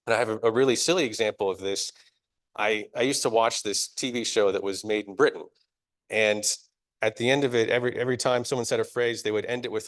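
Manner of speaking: ordinary talk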